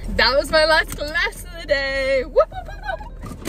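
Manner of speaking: high, cheering singsong; celebrating. Real, not acted. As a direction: whoop it up, drawn out and high at the end. Celebrates last class of the day.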